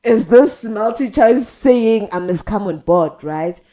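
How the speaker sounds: "Yo, is this MultiChoice" drawn out in shock; gobsmacked by the email; stunned disbelief. Retold or imitated. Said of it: "we're like".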